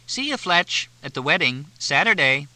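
high, cheery, bubbling over; happy. A narrator's reading. Cindy teasing Fletch about the wedding, high and happy. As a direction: sing it out with glee. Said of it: "Cindy said happily".

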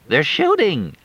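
high, frightened "They're shooting!"; alarmed cry. Acted. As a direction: shout the warning in panic. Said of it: "Crystal screamed".

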